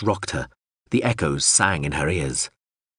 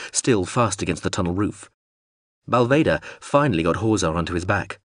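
plain reading voice

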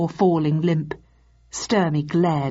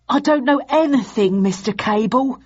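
high, nervous whine; whining; 6 semitones higher, 3 dB louder than usual. Acted. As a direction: plead in a much higher whine. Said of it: "he whined".

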